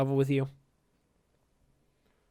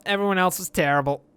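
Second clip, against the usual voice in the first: ordinary talk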